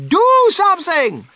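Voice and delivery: high, loud, drawn-out demand; shrieking wife demanding action; screaming. A narrator's reading. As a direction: stretch it into a frantic scream. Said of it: "Missus Gloop screamed at Mister Gloop".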